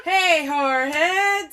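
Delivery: high, drawn-out greeting through laughter; hammed-up greeting. Host greeting the listeners again, hammed-up call. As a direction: call it out long and silly.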